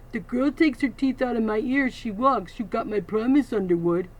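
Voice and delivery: big bully voice, hurting but tough; Bull the thug. Acted. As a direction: bargain through the pain. Bull bargains with ear held.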